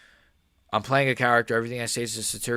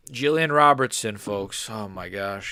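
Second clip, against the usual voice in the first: ordinary delivery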